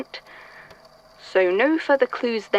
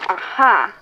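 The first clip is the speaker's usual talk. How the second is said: sudden louder aha of discovery; found it; 4 dB louder than usual. Acted. Archivist finding the missing file, a louder aha. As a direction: pounce on the find. Finds the paper he sought.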